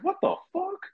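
the rat runs off, high shocked squeal. Retold or imitated. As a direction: shriek it in total disbelief. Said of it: "I was like".

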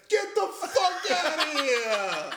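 slow, high, laughing kiss-off; mock dismissal. The bar guy booting a customer, slow laughing yell. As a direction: drag it out and boot them. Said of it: "he's like".

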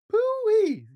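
very high, squealed exclamation; gasped disgust. A narrator's reading. Doctor Duck reacting to Gorilla's smell, high gasped exclamation. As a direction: squeal it high at a terrible stink. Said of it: "gasped Doctor Duck".

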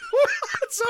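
high, squealing laughter through the words; helpless high-pitched hysterics; cracking up laughing. Real, not acted. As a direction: barely get it out for laughing. Cracking up at co-host's tale.

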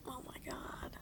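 terrified whisper. Acted. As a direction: breathe it out in fear. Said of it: "I'm gonna whisper".

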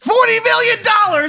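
very high, incredulous squeal; shocked outburst. Acted. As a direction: squeal in disbelief at the huge sum. Shocked reaction to debt.

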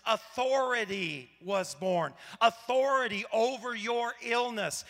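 usual speaking voice

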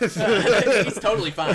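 high, loud, slow put-on voice; character voice.

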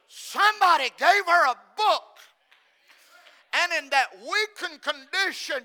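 loud, emphatic preacher's proclamation; full-force preaching. Acted.

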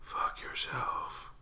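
slow, breathy whispered curse; a slow whispered jab at the band; whispered aside. Real, not acted. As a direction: hiss it under your breath, slowly.

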